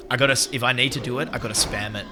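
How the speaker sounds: normal talking voice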